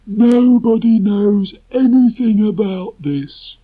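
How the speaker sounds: high, slow gloomy donkey; Eeyore voice. Acted. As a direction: drag it out like a glum donkey. Eeyore grumbling.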